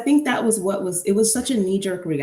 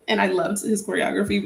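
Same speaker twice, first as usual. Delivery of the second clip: ordinary talk, nothing marked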